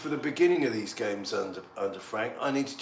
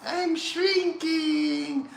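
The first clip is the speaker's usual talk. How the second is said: very high, slow squeaky shrinking voice; cartoon shrinking wail with a laugh; shrinking cry; much slower than usual. Mock shrinking cry after drinking.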